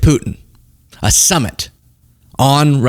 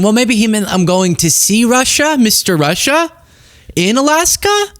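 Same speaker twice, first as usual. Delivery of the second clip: mock bewildered wondering; 8 semitones higher than usual. Host voices a confused guess.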